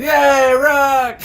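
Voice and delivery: squeaky high, loud giant cheer; gleeful giant. Acted. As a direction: squeal with childlike joy as the giant. GM voices delighted giant.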